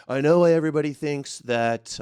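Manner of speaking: lightly done Trump impression; a passing hint of Trump, said half by accident; mild Trump voice, talking to the viewers. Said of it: "I don't know why I'm doing the Trump voice".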